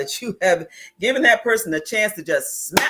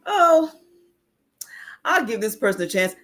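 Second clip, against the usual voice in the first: mock naive trust; 5 semitones higher than usual. Voices what a warrior never thinks.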